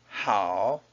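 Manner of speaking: "好" dipping low, then rising; third tone. Shown as a demonstration. Teacher showing learners a third tone, low dipping "好". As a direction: say "好" low with a dip. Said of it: "好 is a third tone".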